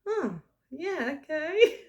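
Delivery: high mock acceptance, ending in a loud laugh; laughing mock reply. Daughter answering a voice from her earbuds, laughing. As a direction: act out going along with it, breaking into laughter.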